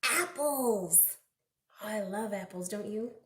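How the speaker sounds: plain reading voice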